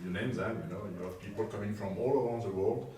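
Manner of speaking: ordinary talk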